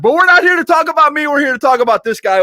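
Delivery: high, fast hype announcer pivot; hyped host pivot. Real, not acted. Host turning the show to his guest, fast and hyped. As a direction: swing the spotlight to the guest, pumped.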